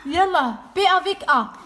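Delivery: put-on loud, high, slow sounding-out for a child; mother drilling her son on syllables, slow and loud; reading lesson. Shown as a demonstration. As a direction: spell it out like a patient teacher. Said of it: "mother sounds out syllables for son".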